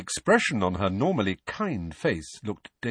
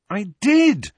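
loud, high protest; indignant boy defending himself; 13 semitones higher, 8 dB louder than usual. A narrator's reading. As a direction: protest indignantly, pitched right up. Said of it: "said Harry indignantly".

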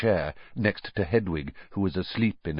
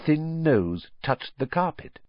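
ordinary delivery

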